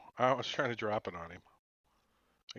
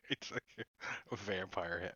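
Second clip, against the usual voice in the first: ordinary talk, nothing marked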